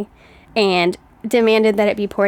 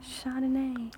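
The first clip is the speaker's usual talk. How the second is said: soft Chardonnay in a mock English accent; quietly tried English accent; 3 semitones higher, 15 dB quieter, slower than usual. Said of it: "Mine is too".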